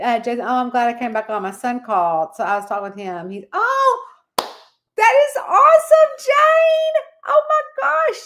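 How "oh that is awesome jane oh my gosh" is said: thrilled. Real, not acted. Thrilled reaction to viewer news.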